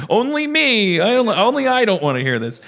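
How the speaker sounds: mock-disgusted high squeal, rushed; squeamish women. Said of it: "the women are like".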